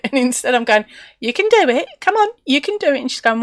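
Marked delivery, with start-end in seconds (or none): 1.2-3.0 sing-song encouragement. Retold or imitated. Cheerful encouragement, teasing.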